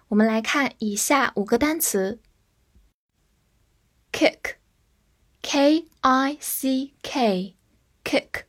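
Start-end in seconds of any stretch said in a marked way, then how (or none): 4.1-8.4 kick, spelled K I C K, each letter slow and clear; teacher spelling kick for students, slowly; slow spelled-out word. Shown as a demonstration. Teacher spells a word for the class, slow and careful. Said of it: "中间字母I发诶这个短音".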